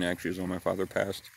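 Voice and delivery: ordinary talk, nothing marked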